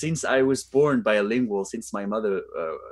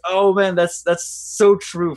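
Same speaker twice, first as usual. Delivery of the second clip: higher, louder imitation of amazed viewers; mock-amazed agreement, pitched up; amazed reaction; 8 semitones higher, 6 dB louder, slower than usual. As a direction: exclaim it like an impressed fan, stretching the end. Acting out amazed viewers' comments.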